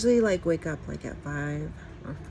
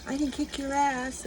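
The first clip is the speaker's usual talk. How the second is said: little tough guy; 8 semitones higher than usual. Retold or imitated. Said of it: "thinking that, you know".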